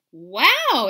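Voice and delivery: loud surprised wow; exclaiming. Acted. Sister exclaiming at the crash, loud. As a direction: gasp it out. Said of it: "exclaimed Jezebel".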